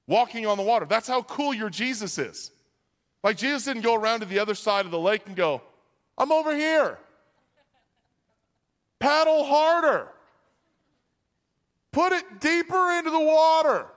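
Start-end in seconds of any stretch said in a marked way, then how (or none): 6.2-13.9 calling out. Acted. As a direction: holler instructions across a lake. Imagined Jesus calling across lake.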